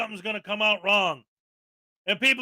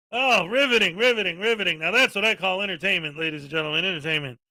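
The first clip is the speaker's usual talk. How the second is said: sarcastic praise; 5 semitones lower, faster than usual. Pretend. Mocking a streamer's building walk-ins as entertainment, sarcastic. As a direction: feign being riveted, voice dropping away drily. Mock praise of filming people.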